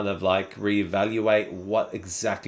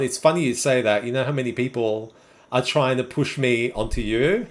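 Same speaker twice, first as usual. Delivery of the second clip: normal talking voice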